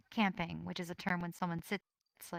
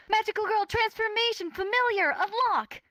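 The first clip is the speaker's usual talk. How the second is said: high, loud transformation chant; bright magical girl; 8 dB louder than usual. Acted. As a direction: shout the spell like an anime heroine. Transformation call in character.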